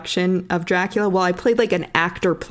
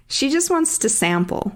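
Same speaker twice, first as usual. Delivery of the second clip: usual speaking voice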